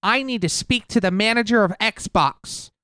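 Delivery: high, demanding Karen voice; entitled customer. Acted. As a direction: demand the manager like an angry Karen. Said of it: "My name is Karen".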